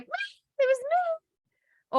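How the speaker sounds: very high, drawn-out little squeal; coyly eager, high-pitched owning-up; happy to claim it. Acted. Worker claiming credit for amazing thing, eager high voice. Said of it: "I'm happy to take it".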